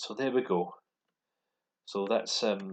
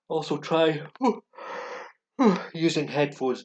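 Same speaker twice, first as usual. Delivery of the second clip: high, breathy sigh breaking in; startled by something off-mic; sudden high outburst; 8 semitones higher, 8 dB louder than usual. Real, not acted. Teacher distracted mid-tip, high startled sigh.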